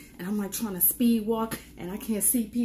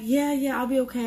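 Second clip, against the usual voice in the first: forced reassurance; 6 semitones higher, 8 dB louder than usual. Retold or imitated. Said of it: "I'm like".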